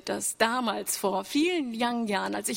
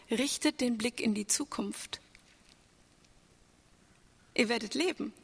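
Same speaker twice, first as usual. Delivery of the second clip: usual speaking voice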